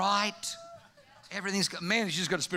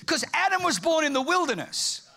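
normal talking voice

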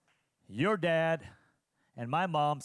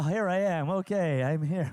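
ordinary talk, nothing marked

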